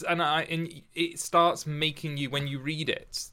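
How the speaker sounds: usual speaking voice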